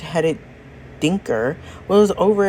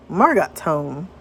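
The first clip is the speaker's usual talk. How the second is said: ordinary delivery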